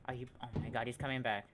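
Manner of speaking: frantic bursts of fear; panicking gamer. Real, not acted. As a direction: blurt it out in rising panic. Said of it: "I'm so bad at panicking".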